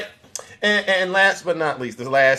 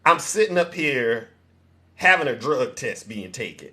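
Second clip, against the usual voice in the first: imitating Mama Cat; 4 semitones lower, 5 dB quieter than usual. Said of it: "you can hear Mama Cat in the background going".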